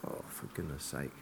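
low, very soft retold grumble; muted exasperation. Driver two miles down the road, muted exasperated grumble. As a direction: grumble to yourself, barely audible. Acted grumble at being sent back.

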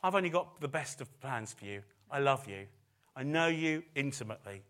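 tender voice of God. Acted. Preacher voicing the Heavenly Father to the listeners, softly. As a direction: reassure them gently as the Father. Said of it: "Father ... wants to say".

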